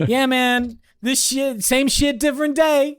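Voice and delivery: high, amused world-weary cop voice; mock-jaded, with a laugh at the end; jaded cop shrug. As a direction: toss it off like a bored New York cop. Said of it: "They're just like".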